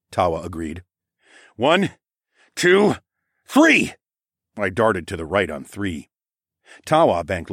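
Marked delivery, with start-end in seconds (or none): 1.5-3.9 building countdown shout. Acted. Fighter counts his partner into a charge, building shout. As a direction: count off, rising higher and louder each beat.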